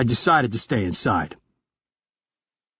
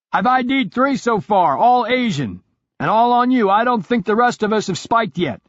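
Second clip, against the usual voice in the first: urgent report, much higher; higher teammate voice; 10 semitones higher, 4 dB louder than usual. Acted. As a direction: report the threat, tense and higher. Retro reports the tail.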